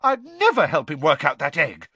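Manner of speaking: high, loud denial; hotly offended; outraged. A narrator's reading. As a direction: protest with outrage. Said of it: "looking outraged".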